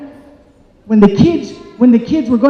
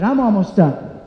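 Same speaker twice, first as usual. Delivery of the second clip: ordinary delivery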